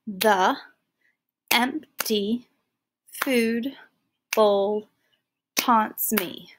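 The empty food bowl taunts me, each word separated by a pause; a teacher counting syllables aloud. Shown as a demonstration. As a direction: read it word by word with a beat after each. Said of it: "let's go over it".

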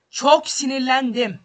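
loud, slow, furious; slow angry shout of frustration; loud angry outburst. Real, not acted. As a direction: bark out his anger.

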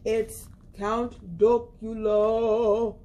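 slow, low spooky announcement; spooky reveal. Acted. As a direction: draw it out like a vampire.

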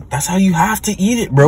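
loud insisting. Real, not acted.

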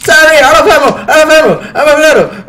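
high, loud panicked yell; panicked shouting. Real, not acted. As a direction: call it out fast and loud in a panic. Panicked shouting when out of ammo.

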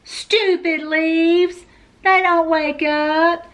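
put-on high, slow childlike grumble; cross complaint. A narrator's reading. Frowning character scolds the leaves.

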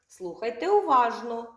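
ordinary talk, nothing marked

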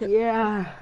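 ordinary talk, nothing marked

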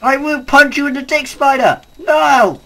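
high, loud furious threat; angry yelling; angry shout. Real, not acted. Spider attacking him.

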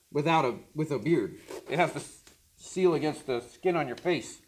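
speaking inside a respirator; muffled through gas mask. Real, not acted. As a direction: voice behind mask visor. Seen 3: Both men wear full gas masks; one removes his at 7.2.

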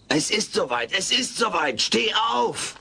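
rising panic, shouting "Steh auf!" high; excited cry that the baby is coming; panicked alarm. Acted. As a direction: sound the alarm, voice climbing. Alf panics labor has begun.

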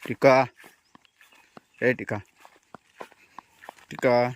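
sharp call across the field. Real, not acted. Owner calling his dog across the field, loud call.

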